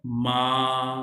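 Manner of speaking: मा held level and a little long; first tone. Shown as a demonstration. Teacher models the first tone, level and long. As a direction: keep मा flat and stretch it. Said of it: "first tone को ऐसे pronounce करते हैं थोड़ा सा लंबा करके".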